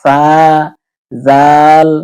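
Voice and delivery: "সা" as tha and "জাল" as dhal; tongue between teeth. Shown as a demonstration. Teacher modelling same-place letters, "সা" and "জাল" with th sounds. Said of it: "দুই দাঁতের মাঝখানে জিহ্বা আসবে".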